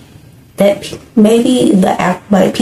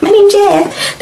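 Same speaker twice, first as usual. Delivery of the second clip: higher, hammed-up failed accent; a clumsy mock accent, pitched way up; mock bad accent; 11 semitones higher, slower than usual. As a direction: parrot the actor's botched accent for laughs. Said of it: "that makes me crack up so hard".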